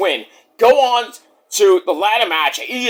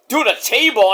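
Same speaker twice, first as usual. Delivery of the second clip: ordinary talk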